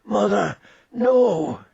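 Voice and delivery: plain reading voice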